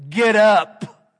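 sharp, loud order; firm command. Shown as a demonstration. Preacher showing God ordering Joshua to rise, firm and loud. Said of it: "When I read it, he said".

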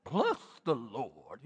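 slow, deep mock-holy blessing; mock pious voice. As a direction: parody a self-important churchgoer. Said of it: "our religious look".

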